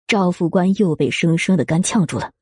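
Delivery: ordinary delivery, nothing marked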